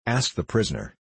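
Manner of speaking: ordinary delivery, nothing marked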